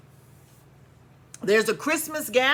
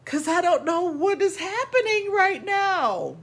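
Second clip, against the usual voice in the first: worked up; 9 semitones higher than usual. Real, not acted. Worked-up list of grievances.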